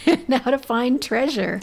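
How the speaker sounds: chuckling through the words; laughing. Real, not acted. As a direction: laugh while saying it.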